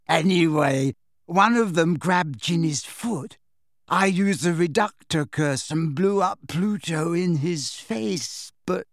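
airy storyteller with lilting lifts; dreamy girl, recounting. Acted. Girl recounts a strange chase, dreamily. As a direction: drift through the tale unhurriedly. Voices Luna recounting the fight.

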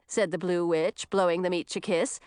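plain reading voice

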